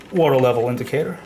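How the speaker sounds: normal talking voice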